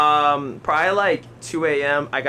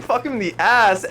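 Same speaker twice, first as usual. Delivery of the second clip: high, drawn-out exclamation; mock shock; 7 semitones higher than usual. Storyteller blurting what he saw, slow mock shock. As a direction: stretch it out in raised mock horror. Retold shock at bathroom scene.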